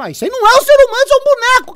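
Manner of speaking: can't believe what he's hearing; exasperated outburst. Real, not acted. Streamer yelling at the debater's claim, exasperated.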